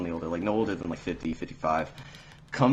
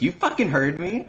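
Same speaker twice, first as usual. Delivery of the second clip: higher-pitched retold retort; mock defiant customer; 9 semitones higher, 3 dB louder than usual. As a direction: imitate a customer standing her ground. Angry customer snaps back, retold.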